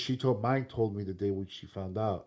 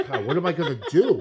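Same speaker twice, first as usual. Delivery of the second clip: laughing mock panic; 4 semitones higher, 7 dB louder than usual.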